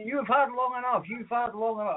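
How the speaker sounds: high-pitched mock warden telling someone off; scolding traffic warden. Traffic warden to driver outside Tesco, high mock scolding. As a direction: imitate a nagging warden, voice pitched right up. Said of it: "traffic warden's sort of waving his finger".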